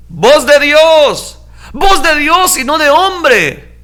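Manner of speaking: high, loud, drawn-out acclaim; crowd shouting. Acted. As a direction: cry it out slowly like an adoring crowd. (gritando como pueblo que aclama)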